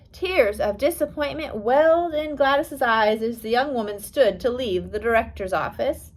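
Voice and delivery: ordinary delivery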